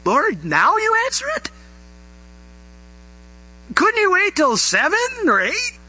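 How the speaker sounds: high, exasperated whine at the Lord; a groggy believer woken in the night, whining high at God; mock exasperated prayer. Woken by a late-night call, whining high to the Lord. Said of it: "And you're thinking".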